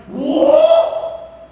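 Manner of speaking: high, loud "whoa" of alarm; mock startled scream. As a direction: shriek it like a startled medium. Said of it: "Why is this woman screaming?".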